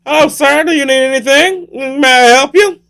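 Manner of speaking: very high, chirped offer of help; oblivious helpful victim; naive polite greeting. Acted. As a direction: greet the stranger in a comic falsetto. Victim politely greets masked killer.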